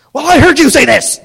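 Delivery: loud, high, exasperated yell; mock argumentative shout. Husband repeating back to wife in a fight, mock-angry shout. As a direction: blast it back as in a spouse fight.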